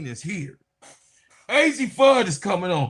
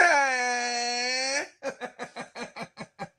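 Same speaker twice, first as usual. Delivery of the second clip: excited laugh; 6 semitones higher, 5 dB quieter than usual. Real, not acted. Laughing after hype shout.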